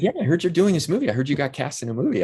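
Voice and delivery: fast, high, eager delivery; retold excited news. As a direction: break the good news in a rush. Director excitedly breaking news, retold.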